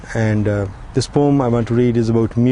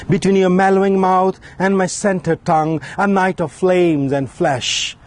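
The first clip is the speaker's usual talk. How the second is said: higher, ringing recital, each stanza opening high; impassioned poem recital; 7 semitones higher than usual. Acted. Poet reciting his love poem to the audience, impassioned and rising. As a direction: build each Between line up high, then let the echoes fall.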